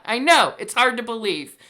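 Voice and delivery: usual speaking voice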